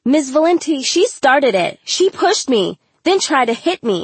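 whiny, high-pitched accusation; indignant tattling. A narrator's reading. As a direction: blame the other girl insistently. Bully protesting to teacher.

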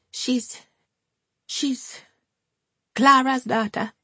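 put-on high, hesitant older Jamaican woman; tentative old woman. A narrator's reading. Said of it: "said Hortense tentatively".

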